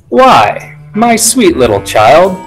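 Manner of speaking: appeasing. A narrator's reading. Covid lying to a little girl caught him, appeasing. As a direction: sweet-talk the child, lifting the opening word. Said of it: "he tried to appease".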